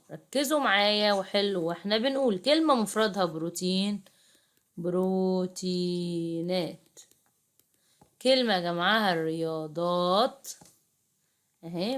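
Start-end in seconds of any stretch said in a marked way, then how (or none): 4.8-6.8 بروتينات stretched syllable by syllable; slow sounding-out. Shown as a demonstration. Teacher giving pupils the plural, slow sounding-out. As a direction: draw out بروتينات slowly and softly.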